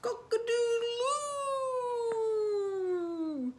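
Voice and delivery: high, long drawn-out crow; rooster crow. Acted. Rooster crows in story.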